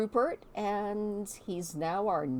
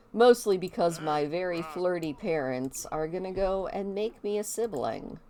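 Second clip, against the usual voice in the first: ordinary talk, nothing marked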